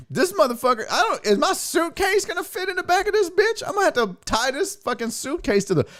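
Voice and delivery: high, disbelieving rant at a tiny car; incredulous rant. Retold or imitated. Comic to audience, outraged at a tiny rental car.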